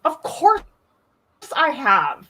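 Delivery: high, loud, slow mock outrage; indignant excited. Real, not acted. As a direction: act offended at the question. Indignant fan answer.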